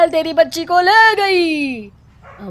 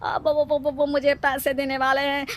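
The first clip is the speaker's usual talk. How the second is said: much softer, halting confession; sheepish confession; 9 dB quieter than usual. Acted. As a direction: admit it under your breath. Fake baba confesses the bribe.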